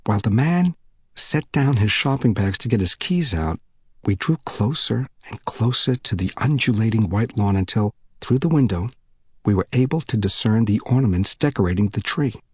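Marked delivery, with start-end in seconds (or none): none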